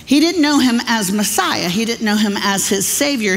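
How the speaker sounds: normal talking voice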